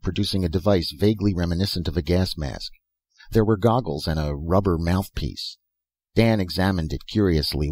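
plain reading voice

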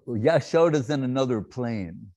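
ordinary talk, nothing marked

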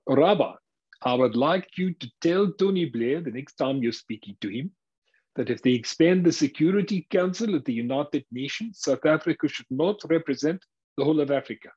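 put-on South African accent. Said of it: "in a South African accent".

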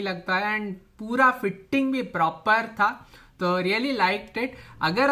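muffled mouth covered; speaking through cloth mask. Real, not acted. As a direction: voice dampened by fabric.

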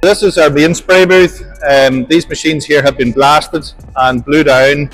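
usual speaking voice

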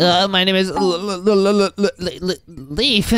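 stuttering repeatedly on the first sound; starstruck stammer. Pretend. Player meeting TV Woman, stammering his name. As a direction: get stuck on L before the name.

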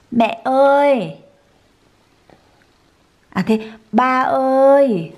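drawn-out words, slow and clear; slow coaxing. Shown as a demonstration. Mother coaxing her baby to say mama and papa, slow. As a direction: stretch each word for the baby. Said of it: "mother coaxing baby to talk".

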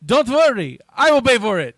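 hammed-up French accent, loud and high; mock Gaultier in a bad French accent. Podcast host quoting Gaultier in bad French accent. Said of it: "That's my bad French right there".

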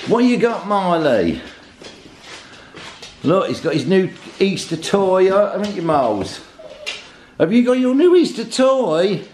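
high, sing-song voice for the dogs; doggy talk. Real, not acted. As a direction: coo at the dogs like babies. Talking fondly to the pet dogs.

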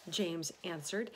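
plain reading voice

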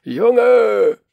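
wild man roaring a warning; roaring shout. A narrator's reading. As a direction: bellow the name across the tracks. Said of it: "springt brüllend".

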